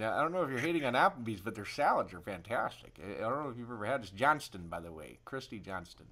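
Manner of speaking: ordinary talk, nothing marked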